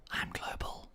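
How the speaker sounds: very soft, voiceless bragging whisper; whispered boast. Pretend. Streamer showing off global rank to viewers, whispered.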